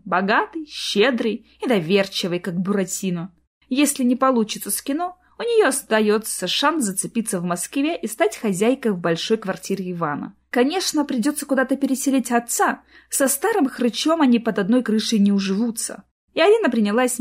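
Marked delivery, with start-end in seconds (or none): none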